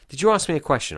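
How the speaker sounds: blurted out high and loud, catching himself mid-ramble; suddenly remember you were asked something; startled, loud self-interruption; sudden realisation. Real, not acted. Realises he drifted off topic.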